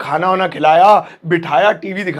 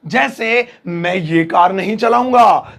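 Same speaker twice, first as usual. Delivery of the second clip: ordinary delivery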